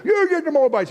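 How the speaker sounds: high, excited cheer; eager crowd cheer. Retold or imitated. Crowd cheering judgment on Moab, high and excited. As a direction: whoop for the punishment.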